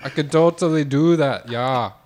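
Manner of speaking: badly faked Jamaican with Russian creeping in; Jamaican-Russian accent. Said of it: "it has a little Russian mixed into it".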